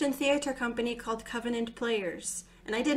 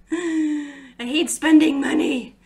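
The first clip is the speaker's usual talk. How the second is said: mock grumpy; 3 semitones higher, 7 dB louder, slower than usual. Real, not acted. Joking confession.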